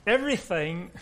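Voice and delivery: attempted British accent; a halting stab at a British accent. Shown as a demonstration. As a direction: try on a British accent, badly. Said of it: "I'll say it with a British accent".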